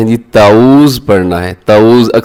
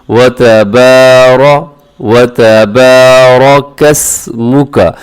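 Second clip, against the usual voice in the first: slow, melodic Quranic recitation; much slower than usual. Shown as a demonstration. Teacher demonstrating tabarakasmuka to students, slow and melodic. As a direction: draw out each syllable. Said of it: "वाव ज़बर वा".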